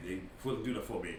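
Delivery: ordinary talk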